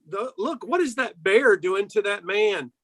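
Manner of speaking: ordinary talk, nothing marked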